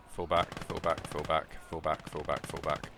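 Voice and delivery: loud repeated order to fall back; frantic retreat order. Acted. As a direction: bark the retreat urgently.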